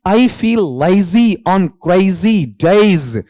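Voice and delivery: each word drawn out and set apart; slow example reading. Shown as a demonstration. Teacher reading the example sentence to students, drawn out. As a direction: read the line aloud for the class, very slowly.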